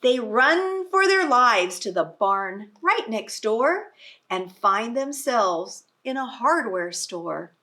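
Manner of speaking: lively read-aloud voice; reading to children. Acted. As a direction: read it out big for a class of little ones. Reading picture book to children.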